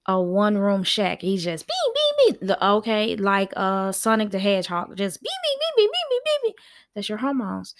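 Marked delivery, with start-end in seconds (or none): 1.7-2.5 very high, squeaky rapid beeps; imitated zooming beeps. Host mimicking hormones racing round a room, high-pitched beeping. 5.2-6.5 very high rapid-fire beeping; imitated zooming beeps. Host likening hormones to cartoon speedsters, frantic high beeps.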